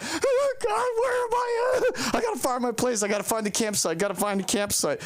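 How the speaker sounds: put-on high, wailing little-kid voice; crybaby. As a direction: whine like a lost scared child. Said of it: "a ten-year-old little crybaby".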